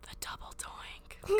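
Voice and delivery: hushed dramatic whisper; breathy slow reveal. Pretend.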